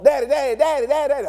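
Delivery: put-on high, insistent child's call; child calling daddy. Child begging father to look at him. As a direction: pester like a small kid tugging at a sleeve.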